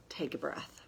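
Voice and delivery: soft breathy whisper. Acted. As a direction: hush it, almost no voice. Guided pause in reflection.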